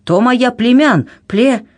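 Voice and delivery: high, sputtering indignation; worked-up cry that breaks off; indignant. A narrator's reading. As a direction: sputter and choke on the word. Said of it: "голос его осип от возмущения".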